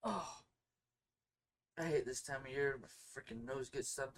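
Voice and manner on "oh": ordinary talk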